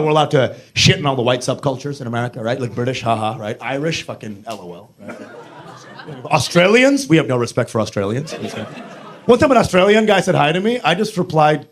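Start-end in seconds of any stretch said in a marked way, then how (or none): none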